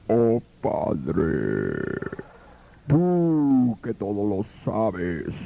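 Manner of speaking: very low, very slow intoning; hammed-up sepulchral voice; mock beyond-the-grave prayer. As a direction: drag it out like a spooky prayer. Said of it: "Como voz de ultratumba".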